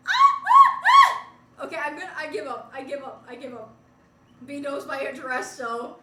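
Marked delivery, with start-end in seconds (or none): 0.1-1.3 piercing, very high seagull squawks; seagull cry. Acted. Streamer retrying a seagull call, loud high squawk. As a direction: let out loud repeated gull calls. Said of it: "do a seagull".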